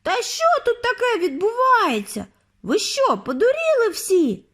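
put-on high, crying boy's wail; panicked wail. A narrator's reading. Frightened Bruno wails at the crowd of witches. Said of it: "залементував Бруно".

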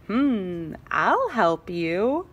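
slow sly menace; creepy Sharpener. Acted. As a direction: sound like you might bite. Sinister Sharpener offers help.

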